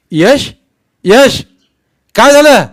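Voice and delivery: loud, high frantic calling after him. A narrator's reading. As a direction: shout after him in alarm. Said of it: "म्हणत मी त्याच्या पाठोपाठ पळालो". (घाबरून मोठ्याने हाक)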